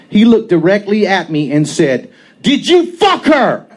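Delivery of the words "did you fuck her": Scott's question. A narrator's reading. Scott's enraged question, slow.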